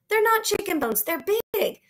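put-on high-pitched childish protest; indignant. Child defends dinosaur find to classmates, indignant. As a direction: object hotly, pitch way up. Bean protests accusation of faking.

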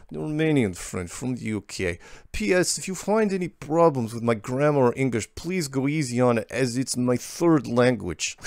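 badly faked Russian accent; terrible Russian accent. Host reading a Romanian friend's email aloud, terrible Russian accent. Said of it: "in my terrible Russian accent".

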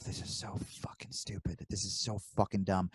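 whispered, under-the-breath grumble; muttering to himself. Retold or imitated. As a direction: grumble it so nobody else hears. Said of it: "I keep just muttering to myself".

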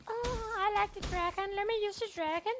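high, fast whiny mockery of a teammate; mocking teammate. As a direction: sneer it out in a silly high voice. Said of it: "my team is like".

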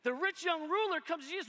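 ordinary talk, nothing marked

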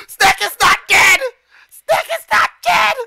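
very high, loud, drawn-out scream; screaming disbelief. Real, not acted. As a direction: shriek it in overjoyed shock, gasping first. Favorite Pokemon survives, screaming.

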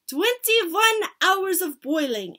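slow, high, loud pronouncement; pronouncing witch. A narrator's reading. Grand High Witch answering the audience, pronouncing. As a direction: draw it out grandly.

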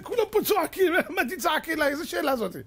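high-pitched, incredulous complaint; indignant protest. Acted. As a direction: protest as if the question is absurd. Acts indignant reaction to God's answer. (מחאה נדהמת בקול גבוה)

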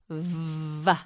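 "V" as a long buzzing vvv-uh; teeth on lip. Shown as a demonstration. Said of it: "teeth on your bottom lip".

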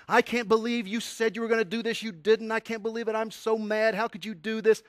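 high-pitched, loud whiny tantrum; teen ranting at his mom for not buying the album, high and loud; teenage rant. Retold or imitated. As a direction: rant at mom like an angry fourteen-year-old. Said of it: "I just ranted and raved".

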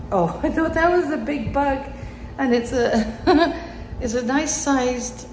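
high, loud laugh through the words; giggling at her own fright. Real, not acted. Laughing to viewers at a big dust bunny.

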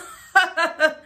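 high, loud burst of laughter. Real, not acted. Laughs at the clip.

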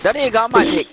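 ordinary talk

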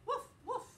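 high, bouncy woof woof; playful dog bark. Acted. Teacher barking for Biscuit to the kids, high and playful. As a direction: bark like a happy puppy.

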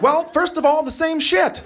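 high-pitched comic exasperation; exasperated dad. Acted. Dad answering son in the canoe, exasperated. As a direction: answer in a high, fed-up rant.

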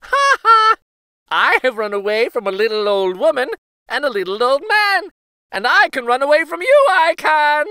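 high, loud and fast taunt; laughing gingerbread boy. A narrator's reading. Gingerbread boy taunting the cow, high fast bragging. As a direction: brag quickly with a laugh. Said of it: "laughed and said".

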